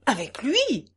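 startled question, pitch leaping on lui; indignant exclamation. A narrator's reading. Count told to reconcile with his enemy, indignant outcry. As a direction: exclaim it in affronted disbelief. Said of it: "s'écria le comte".

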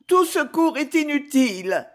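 high-pitched, despairing lament. A narrator's reading. Despairing woman refuses help. (plainte désespérée, aiguë)

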